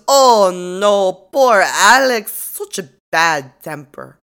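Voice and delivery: put-on hammy Russian accent, lingering on poor Alex; mock Russian accent. Russian suspect voiced in recap.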